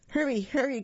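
high eager hurry-hurry; excited cry. A narrator's reading. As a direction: urge them on, thrilled. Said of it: "cried the funny little clown".

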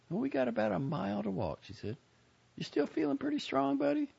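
lightly voiced young woman. A narrator's reading. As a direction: voice the sister, curious. Joni voiced high.

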